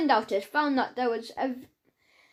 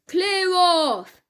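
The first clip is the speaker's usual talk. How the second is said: loud, higher, gruff policeman; angry order; 6 semitones higher, 4 dB louder than usual. A narrator's reading. Policeman ordering the laughing boy away, angry. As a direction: bark it at the boy.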